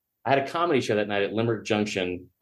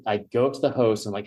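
normal talking voice

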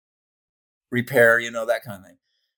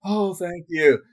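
grateful patient; 4 semitones higher, slower than usual. Retold or imitated. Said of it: "the guy was like".

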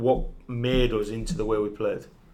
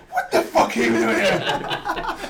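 high, drawn-out disbelief through laughter; mock incredulous outburst; much slower than usual. Player retells manager finding lad in laundry bin, mock incredulous. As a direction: imitate a stunned gaffer, slow and high-pitched.

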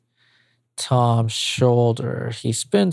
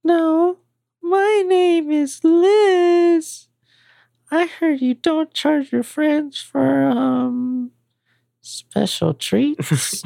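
put-on much higher girlish voice; young woman voice. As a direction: play a coy young woman. Liz character high voice.

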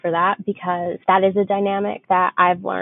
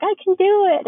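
much higher, insistent little protest; lightly voiced grandma insisting, higher; stubborn grandma; 12 semitones higher than usual. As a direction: play the proud wife refusing help.

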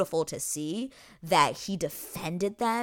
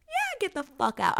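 high, pitched-up dismissal; mock kick-out. Host throwing out rude guests, high mock dismissal. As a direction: shoo them out in a squeal. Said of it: "I'm like".

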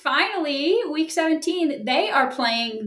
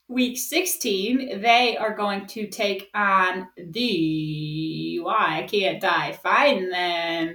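very slow, words stretched to buy time; stalling; 4 semitones lower, much slower than usual. Real, not acted. Host hunts for the next opponent, stalling slowly. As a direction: drag each word out while searching. Stalling while searching her notes.

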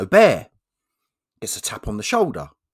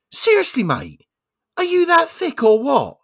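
much higher, louder exasperated bear voice; 8 dB louder than usual. Acted. Bear scolds the hunter again, high and exasperated. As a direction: lay into him as a fed-up bear.

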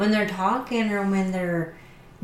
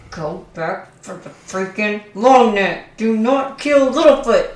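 louder, slow pleading shout; yelling at the screen; 2 semitones higher, 8 dB louder than usual. Retold or imitated. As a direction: yell at the movie to save Littlefoot.

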